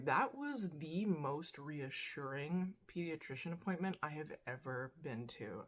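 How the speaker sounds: ordinary talk, nothing marked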